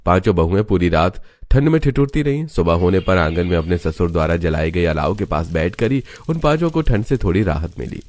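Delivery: ordinary delivery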